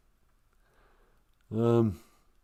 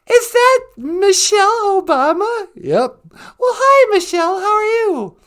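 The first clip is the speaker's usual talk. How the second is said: high excited greeting; 6 dB louder than usual. Acted.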